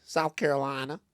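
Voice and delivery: lightly imitated Southern lady; Southern accent. Lady answering where she is from, imitated Southern accent. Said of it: "They have very thick Southern accents".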